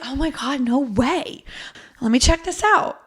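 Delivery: excited. Retold or imitated. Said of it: "So I was like".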